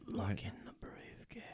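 breathy unvoiced whisper from inside the case; eerie whisper. A voice in the briefcase whispers to the magician's assistant. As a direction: lure him in with a hushed whisper.

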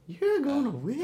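high, quiet mocking old-timer; old man voice. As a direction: imitate his old man voice. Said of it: "your old man, your Halloween voice".

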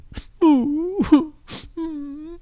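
high rising boo-hoo wail; a little rabbit sobbing under a rock; bunny crying. Acted. Sad bunny crying alone under a paper rock, high wail. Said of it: "and he was crying".

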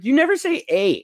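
high-pitched upset complaint; upset girlfriend. Retold or imitated. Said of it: "she was genuinely upset".

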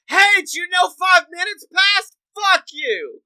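shrill screamed clock voice, high and loud; screaming clock. Retold or imitated. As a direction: yell it like a deranged clock. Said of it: "the big ass loud clock that every few minutes is like".

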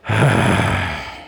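long, falling impatient sigh; rumbling sigh. Acted. Bored barista at a slow customer, rumbling sigh. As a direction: let out a weary, impatient breath. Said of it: "He lets out a rumbling sigh".

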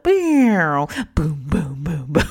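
beatbox the bass thumping through the ceiling; mock club beat, booming bass; imitating bass drops. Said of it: "it'd be like".

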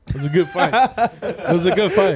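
laughing. Real, not acted. Repeats answer, laughing it off.

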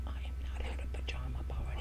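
shocked unvoiced whisper. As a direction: breathe it in horror. Waking up horrified, whispered.

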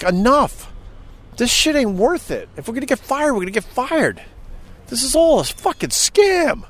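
high-pitched exasperated rant; fed-up, high-pitched protest; retold outburst. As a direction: put your foot down, voice pushed high. Retold outburst to boss and staff.

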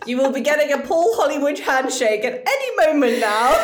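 giggling through a grand pronouncement; laughing mock-formal voice; laughing mock announcement. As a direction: deliver it pompous while cracking up. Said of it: "Oh, yeah, does my dick taste nice?".

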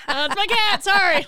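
high, loud flustered apology, acted out. Explaining her cat to podcast listeners, loud flustered apology. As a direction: blurt it out loudly, re-enacting a fright.

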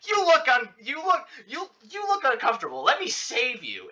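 high-pitched mock friend voice; imitating pushy rescuer. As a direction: pipe up high, stumbling into the rescue. Friend cuts in at slow dance.

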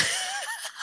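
a squeaky shocked laugh; real high laugh. Real, not acted. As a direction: burst of high, startled laughter. Reacting to mom's news.

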